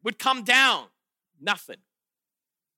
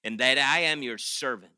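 much lower, hushed whispered prayer; 11 semitones lower, 5 dB quieter than usual. Retold or imitated. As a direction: pray in a breathy whisper. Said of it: "He prays to the Lord".

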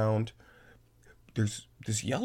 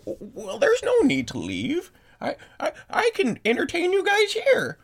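put-on high, stammering old lady; flustered, high hostess pleading to keep guests; insistent old host; 6 dB louder than usual. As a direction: pitch way up, stumbling then louder as you insist. Host voice objects to guests leaving.

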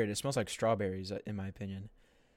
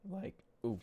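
voice crack; 7 semitones higher, 5 dB quieter than usual. Real, not acted. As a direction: voice cracks high on the ooh. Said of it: "voice crack".

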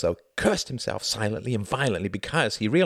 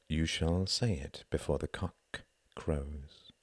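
very soft, low and slow; barely audible murmur; 5 semitones lower, 9 dB quieter, slower than usual. A narrator's reading. Pilot leaning in with a prophecy, barely audible murmur. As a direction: murmur it slowly, fading away. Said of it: "barely audible".

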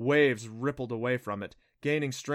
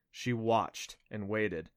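plain reading voice

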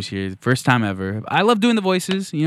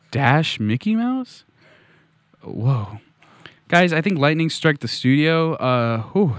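ordinary talk

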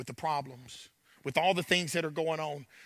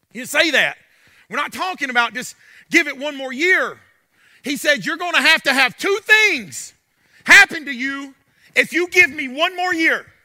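loud, higher, driving sermon voice; preaching at full force; 7 semitones higher, 11 dB louder than usual. Acted. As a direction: preach it hard, voicing God's words with fire.

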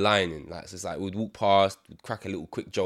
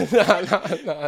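much higher and louder laughing nah; 11 semitones higher, 10 dB louder, slower than usual. Real, not acted. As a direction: laugh the nahs away. Laughing aloud.

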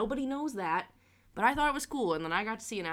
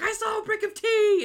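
high, loud excited squeal; 14 semitones higher, 7 dB louder than usual. Retold or imitated. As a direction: burst out with the big news. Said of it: "So excited".

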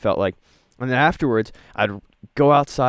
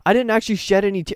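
baffled self-quote; 10 semitones higher than usual. Retold or imitated. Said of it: "And I was like".

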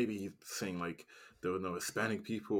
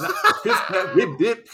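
high, loud laughing tease; laughing mockery; 13 semitones higher, 15 dB louder than usual. Real, not acted. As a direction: crack up while teasing the slip. Friend mocks the name slip.

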